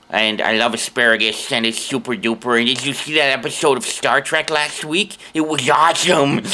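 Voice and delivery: high nasal-free sci-fi nerd voice, excited; geeky voice. Shown as a demonstration. Showing fans his standard geeky nerd voice from videos. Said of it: "I did this voice, which is sort of my standard issue geeky voice".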